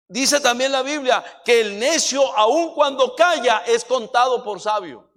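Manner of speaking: loud, pounding preacher's delivery; loud sermon climax; full-force preaching. Acted. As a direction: drive each line home to the congregation.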